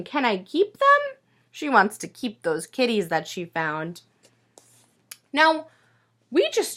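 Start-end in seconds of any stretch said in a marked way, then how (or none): 0.0-1.2 lightly voiced pleading little girl; a hint of a small girl's plea. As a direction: ask it like a child begging for kittens. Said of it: "this little girl is saying".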